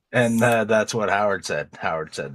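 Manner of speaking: ordinary delivery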